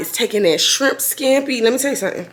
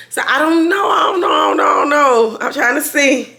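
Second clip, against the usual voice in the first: high, sing-song dithering on repeat; whiny indecision; 7 semitones higher, 3 dB louder than usual. Real, not acted. Vlogger torn over dinner plans, whiny and high. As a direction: draw out your indecision in a high whine.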